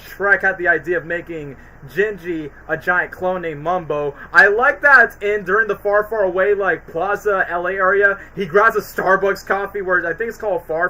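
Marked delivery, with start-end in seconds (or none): none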